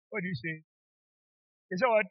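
ordinary talk

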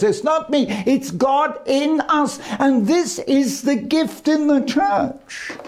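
preacher slowing right down for weight; slow, deliberate emphasis. Shown as a demonstration. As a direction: lean in slowly to drive the point home. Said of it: "why am I talking like this".

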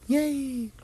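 very high squeaky Yay!. Retold or imitated. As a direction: push the Yay! way up. Observer cheering louder.

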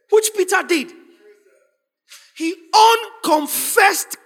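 loud, high, slow declamation; full-force preaching. Real, not acted. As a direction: thunder it out to the congregation, stretching the words.

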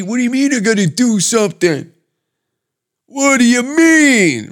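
mock a sulky kid told to do chores; whiny Gen Zer. Mocking Gen Zers upset about the draft, in a high whine. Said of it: "They're s-, fucking stomping their feet".

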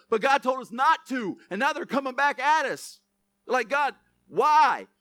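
loud, high preaching, building the drama; full-force preaching. Acted. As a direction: preach the story to the crowd. Preaching the battle story.

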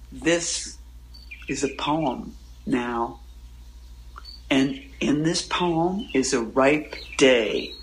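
mock a poet saying every word carefully; overly careful poetry reading. Poet mocking pretentious readers, slow and overly careful. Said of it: "people saying words really carefully".

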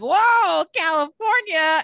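high, loud awed whoa; mock amazed. Bar regulars meeting a Californian, high loud amazed whoa. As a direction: gush like California is exotic. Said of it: "And they're like".

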